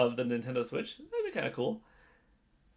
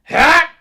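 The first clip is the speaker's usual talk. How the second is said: sharp high yell "Hah!"; Link battle cry; 23 dB louder than usual. Pretend. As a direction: shout a short heroic grunt. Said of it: "can only grunt and scream".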